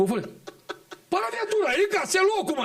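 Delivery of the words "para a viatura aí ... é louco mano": grito alto de sargento; bronca gritada. Retold or imitated. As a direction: manda parar a viatura gritando. Parando viatura, bronca no noia. (grito de bronca)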